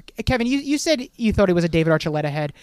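normal talking voice

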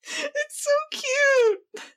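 slow, high squeal of delight; gushing. Real, not acted. Fan gushing to her co-host about cute art, squealing. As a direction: melt over how cute it is.

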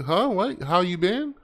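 high, puzzled questioning; confused mishearing. Acted. Learner acting out locals mishearing the Dutch phrase, puzzled. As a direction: play someone who misheard a greeting. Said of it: "people gonna think you say".

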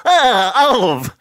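loud, slow, drawn-out cartoon sailor; Popeye. Host mocking his own failed British, cartoon sailor impression. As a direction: call it out in a put-on cartoon voice. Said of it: "I sound like Popeye".

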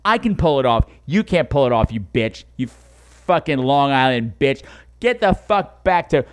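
ordinary delivery

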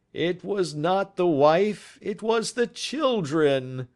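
a father groaning in despair. A narrator's reading. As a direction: let the pain pull the words out. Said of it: "groaned the prisoner".

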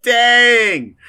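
high, loud, drawn-out whoop; impressed exclamation. Real, not acted. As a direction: burst out at a bold comeback. GM reacts to the player's retort.

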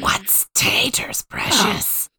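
slow whispered Gollum rasp; too-deep Gollum. Pretend. Trying Gollum on the podcast, a slow whispered rasp. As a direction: breathe out a low Gollum hiss. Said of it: "No, that was way too deep".

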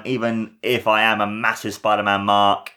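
ordinary talk, nothing marked

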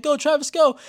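high, loud cheer; root for him like a fan in the stands. Retold or imitated. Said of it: "I'm like".

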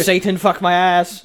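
hammed-up call to Satan; mock satanic invocation. Friend joking about a Satanic ritual, mock invocation.

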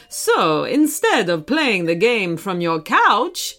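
hearty video game hero, put-on voice; Mario. Mario welcomes Archie into the game, hearty character voice. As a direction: welcome them in a lilting cartoon hero voice.

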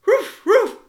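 loud, high yappy woofs; dog barking. A narrator's reading. Dog joining the concert, loud barking. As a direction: bark like a dog. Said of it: "barked the dog".

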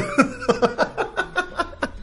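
high, soft helpless laughter; real laughing fit. Real, not acted. Comedians cracking up at the crying couple, real laughter.

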